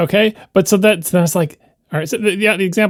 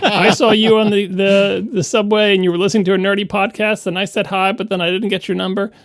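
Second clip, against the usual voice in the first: higher-pitched mock lovelorn message; missed-connection ad; 5 semitones higher than usual. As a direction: play a nerdy missed-connection post, pitch raised.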